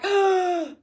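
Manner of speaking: sharp, high mock gasp; acted gasp of nerves; nervous gasp. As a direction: suck in a breath as if nervous. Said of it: "that's a little nerve-wracking".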